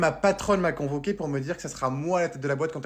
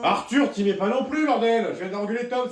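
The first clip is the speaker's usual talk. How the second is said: high, raised parental scolding; angry telling-off; 6 semitones higher, 3 dB louder than usual. Acted. Father warning Arthur after scolding Tom, angry. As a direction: yell a warning, fed up. Father yells at second son.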